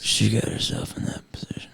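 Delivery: whispered, hushed. Acted. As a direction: breathe it as a secret. Conspiratorial skit line.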